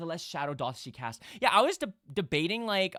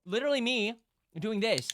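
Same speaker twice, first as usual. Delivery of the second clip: ordinary talk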